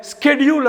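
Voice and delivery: ஸ்கெடியூல் starting with hard 'sk'; American pronunciation. Shown as a demonstration. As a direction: begin ஸ்கெடியூல் as 'SKED'. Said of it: "அமெரிக்கால". (ஸ்கெடியூல் - அமெரிக்க 'ஸ்க' உச்சரிப்பு)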